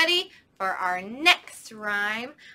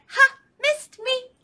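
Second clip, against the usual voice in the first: high, loud singsong taunt; teasing monkey; 13 semitones higher, 9 dB louder than usual. Acted. Monkey teasing the alligator, high playful taunt. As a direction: taunt like a cheeky little monkey.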